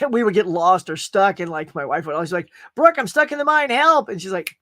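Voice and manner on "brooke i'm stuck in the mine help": high, loud playful plea for rescue; mock cry for help. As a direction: call out in hammed-up distress. Retelling his mock plea to wife.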